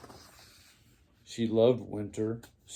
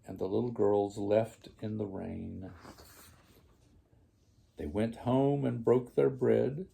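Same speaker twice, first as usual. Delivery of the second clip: plain reading voice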